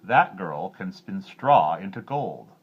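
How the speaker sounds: slow, loud boast; a miller who can't hold his tongue; unguarded boast. A narrator's reading. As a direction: let the boast slip out slowly. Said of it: "couldn't begin to control his tongue".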